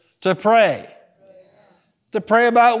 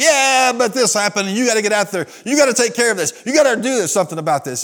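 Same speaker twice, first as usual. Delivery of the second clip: high, agitated objection; mock protest, pitched up; retold insistent protest; 4 semitones higher than usual. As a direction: push back as a worked-up complainer. Retold member insisting over calm pastor.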